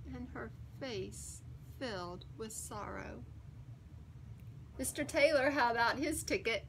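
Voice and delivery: plain reading voice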